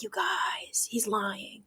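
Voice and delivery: conspiratorial whisper to the class; stage whisper. Retold or imitated. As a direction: lean in and whisper the secret. Teacher hushing students about Iago.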